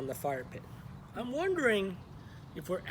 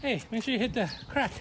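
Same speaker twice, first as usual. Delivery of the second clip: usual speaking voice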